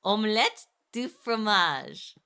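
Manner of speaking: slow, clumsy "Omelette du fromage" with an English accent; self-conscious bad French. Shown as a demonstration. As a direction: force the French phrase awkwardly. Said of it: "my accent being this bad".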